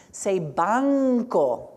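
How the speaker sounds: banco said slowly, stretching the n before the k; slow pronunciation demo. Shown as a demonstration. Teacher to class: slow model of banco. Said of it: "we also say nk".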